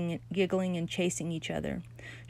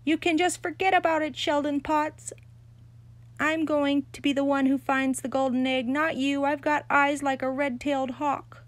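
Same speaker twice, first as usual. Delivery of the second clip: high, loud challenge; a rival girl shouting across the yard at Sheldon; defiant shout; 7 semitones higher, 3 dB louder than usual. A narrator's reading. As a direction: hurl it after him. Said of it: "she shouted after him".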